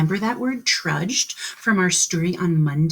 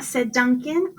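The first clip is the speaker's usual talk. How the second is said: ordinary delivery, nothing marked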